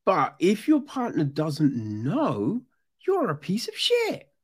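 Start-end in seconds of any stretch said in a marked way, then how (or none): 3.0-4.3 high-pitched contemptuous put-down; disgusted condemnation; scornful verdict. Real, not acted. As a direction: spit out the insult. Condemning cheating partner.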